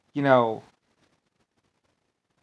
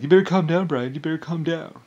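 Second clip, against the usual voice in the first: Rick Bush; 4 semitones higher, 3 dB louder than usual. Retold or imitated. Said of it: "Rick Bush is like".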